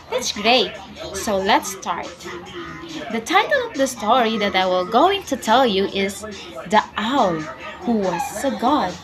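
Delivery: ordinary delivery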